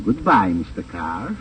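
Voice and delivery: ordinary talk, nothing marked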